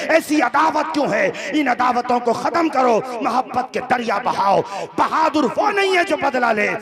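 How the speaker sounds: high, ringing sermon cry; fiery preaching. Acted. Preacher cries out a mother's sacrifices to the crowd in full fervour. Fervent preaching against feuds.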